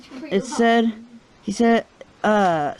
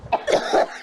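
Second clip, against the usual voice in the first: coughing. Real, not acted. Smoke fills the room.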